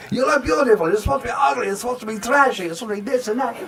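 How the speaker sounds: imitated director's angry rant, high-pitched; Andre. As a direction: yell an exasperated mock scolding at an actress. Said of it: "he likes to yell. He gets mad very easily".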